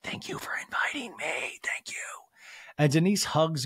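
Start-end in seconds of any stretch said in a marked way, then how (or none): 0.0-2.0 breathy voiceless whisper, very soft. Retold or imitated. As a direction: hush it like a secret at a party. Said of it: "Denise whispers".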